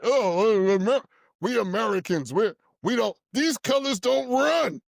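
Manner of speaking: lightly mocking chest-thumping slogan; mock patriot. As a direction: sneer it like a proud patriot. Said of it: "They love that one".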